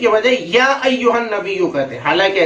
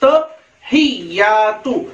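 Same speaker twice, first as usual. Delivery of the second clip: "अत्तहियातु" slowly in separate pieces, "at-ta / hiy-yaa-tu"; syllable-by-syllable teaching demo of "अत्तहियातु"; broken into pieces; much slower than usual. Shown as a demonstration. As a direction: pause inside "अत्तहियातु", drawing out each part. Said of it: "फिर और टुकड़े टुकड़े करके".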